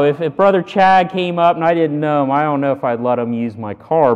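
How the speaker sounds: ordinary delivery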